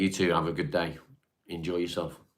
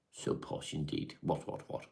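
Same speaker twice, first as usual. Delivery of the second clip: mock posh whisper; 3 semitones lower, 9 dB quieter than usual. Mocking posh toff voice.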